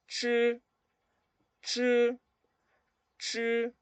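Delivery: "chi" as unaspirated retroflex ch; high, level "chi", tongue back, breath held in; ch without air. Shown as a demonstration. Teacher showing ZH to learners, "chi" without air. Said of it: "pero sin aire".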